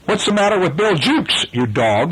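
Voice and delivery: high, demanding captain's question; mild hiss. A narrator's reading. Hook towering over his pirate, mildly menacing demand. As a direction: start high and drop on the insult. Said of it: "hissed Hook".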